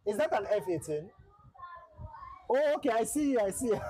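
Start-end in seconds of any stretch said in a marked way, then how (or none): 2.5-3.8 sudden high, bright "oh, okay" of surprise; surprised. Real, not acted. Streamer spotting his friend's jet behind him, surprised. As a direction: jump up in pitch as you spot him. Spots the friend's plane on map.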